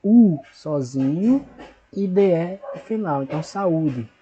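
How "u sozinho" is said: u stretched out slowly and a bit louder; slow syllable demo. Shown as a demonstration. Teacher isolating the syllable for children. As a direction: draw out the u slowly on its own. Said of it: "Então, fica sa".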